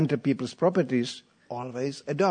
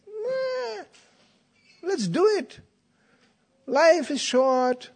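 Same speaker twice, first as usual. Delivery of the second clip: mock hedonist; 14 semitones higher, much slower than usual. Mocking hedonist attitude.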